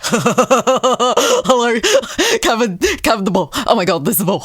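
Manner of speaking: a hint of an excited dog; fast, eager dog chatter, lightly done; lightly voiced hyper dog. Channeling brother's hyper dog, eager and fast, lightly voiced. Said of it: "He's just hypo".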